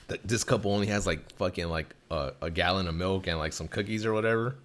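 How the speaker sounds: ordinary talk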